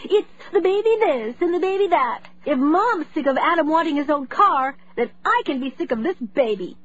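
put-on high, resentful girl ranting; upset rant. A narrator's reading. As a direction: spit it out, fed up. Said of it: "Zoe cried".

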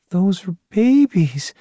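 high, slow, distraught woman's cry. A narrator's reading. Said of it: "Terry cried, distraught".

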